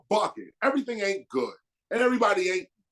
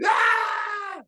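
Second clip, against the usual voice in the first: high shrill mock scream; child's scream; 14 semitones higher than usual. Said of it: "You screaming".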